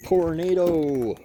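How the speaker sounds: loud, high, drawn-out cry; excited call-out. Real, not acted. Junkyard explorer spots a favorite car, excited call-out.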